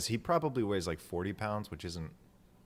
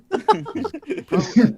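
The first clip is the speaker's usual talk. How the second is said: laughing hard; 15 dB louder, much slower than usual. Real, not acted.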